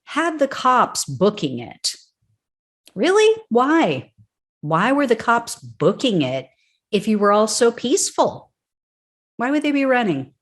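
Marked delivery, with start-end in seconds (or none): 2.9-4.1 sarcastic shock at the boast; mock disbelief. Real, not acted. Host mocking the rioter's boast, high incredulous squeal. 4.6-10.3 higher, pointed rhetorical questions; sarcastic questioning. Real, not acted. Host challenging the rioter's claim of peace, higher sarcastic questions.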